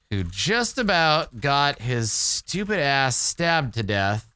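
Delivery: slow, measured storyteller with long pauses; wry story narration. Acted. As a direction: recount it like a drawn-out tale.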